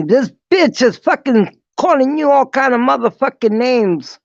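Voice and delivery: high, loud, indignant gossip; worked-up sister. Retold or imitated. Sister tells the speaker about the insults, high and riled up.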